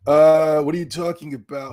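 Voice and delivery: normal talking voice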